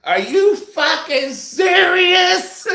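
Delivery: high, slow drawn-out yell, half sung; screaming. Retold or imitated. Husband retelling yelling at the TV in a slow wailing scream. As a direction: stretch the rage into a wailing howl. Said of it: "I'm screaming".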